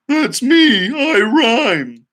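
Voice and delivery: pony butting into the song history; excited pony. Acted. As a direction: pop in proudly like a pony. Pony interrupts again.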